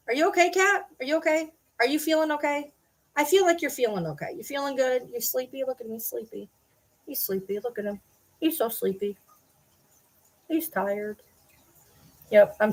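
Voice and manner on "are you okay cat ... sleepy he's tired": gentle, hushed pet voice. Real, not acted. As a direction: coo softly at a sleepy cat. Baby talk to the cat.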